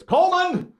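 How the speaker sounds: very high holler toward the back; imitated high, loud name call. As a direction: holler for someone out of sight. Worker calls name toward the back.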